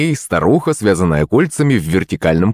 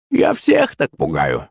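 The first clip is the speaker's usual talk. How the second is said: frowning; 5 semitones lower, slower than usual. Acted. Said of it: "нахмурилась старуха".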